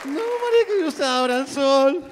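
high-pitched mock outcry; exclamación actuada. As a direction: cry it out high, in playful disbelief. Acted outcry in a stand-up bit. (exclamación aguda y burlona)

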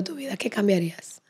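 hushed, breathy whisper; whispered question. Real, not acted. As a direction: lean in and whisper it. Asking a personal question.

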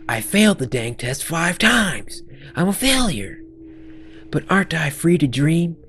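high, pleading outburst; acted distraught student; despairing character. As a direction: climb into a high cry of self-blame.

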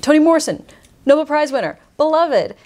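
lightly acted awe, pitched well up; mock impressed. Bookseller voicing a buyer's thought about a famous author, impressed.

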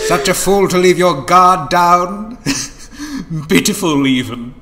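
loud high gloating taunt with a cackle. Acted. Master triumphs over the betrayed Rani, gloating loudly. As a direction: crow over your fallen rival.

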